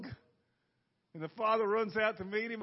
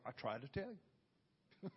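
grandmother; 7 semitones lower, 13 dB quieter, slower than usual. Retold or imitated. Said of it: "It's like my grandmother".

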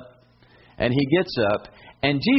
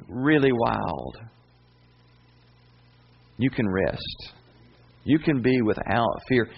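calm reassurance; slower than usual. Acted. Sermon drop to calm assurance.

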